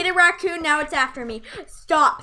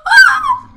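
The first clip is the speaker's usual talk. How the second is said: startled scream; 15 dB louder than usual. Real, not acted. Sudden scare in game.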